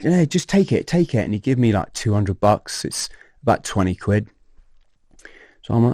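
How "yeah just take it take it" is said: high-pitched urging; imitated insistence. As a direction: press it on a reluctant mate, pitched high. Imitating friend pushing money on him.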